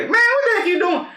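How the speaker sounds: high, loud mock scold; hammed-up disapproving grandpa; imagined scolding. Imagined grandpa scolding him for the videos, high and loud.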